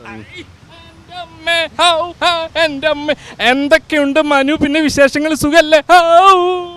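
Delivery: loud, exclamatory comic impersonation; mimicry voice. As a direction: ham it up as a mimic's character, with drawn-out howls. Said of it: "Mahabali നന്നായിട്ട് mimicry കാണിക്കണ്ടല്ലോ".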